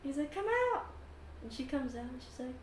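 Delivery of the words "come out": high, eager summons; calling out. Acted. Elf calling his wife out of the carriage, high call. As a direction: call someone outside, pitched high.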